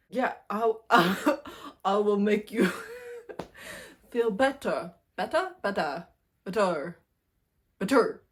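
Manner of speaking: put-on aggressive Russian accent. Man soothing the heroine in a loud mock Russian accent. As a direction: ham up a pushy Russian accent. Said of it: "he has his Russian accent".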